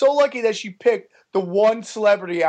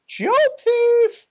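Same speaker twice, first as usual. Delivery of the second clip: very high-pitched imitated heckle; heckler's yell. Girl heckling a comic mid-set, mock high yell. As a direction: screech it like a girl in the crowd. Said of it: "a girl yells".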